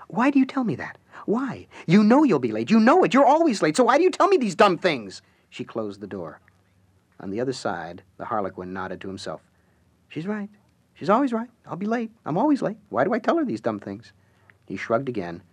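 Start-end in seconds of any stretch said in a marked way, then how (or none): 0.1-5.2 high, loud scolding; exasperated scolding. Acted. Alice scolding Everett at the door for always being late, exasperated. 10.1-14.1 lightly voiced high rueful musing; rueful Harlequin. The Harlequin behind the door agreeing with his wife, lightly rueful.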